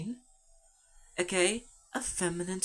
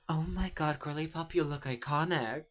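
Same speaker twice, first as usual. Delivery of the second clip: ordinary talk, nothing marked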